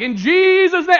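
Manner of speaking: loud, high hammed-up faith-healer cry; mock preacher. Mocking a showy healer praying over the coach, loud and high. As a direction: ham up a big healing prayer. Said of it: "I didn't have to be like".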